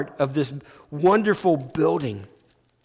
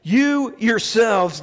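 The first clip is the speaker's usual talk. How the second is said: YOU YOURSELVES slow, raised and weighted; emphatic; 4 semitones higher, 4 dB louder, much slower than usual. Retold or imitated. Preacher repeating Peter's emphatic words, "You yourselves" slow and high. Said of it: "He's emphatic about this".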